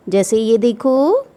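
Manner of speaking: normal talking voice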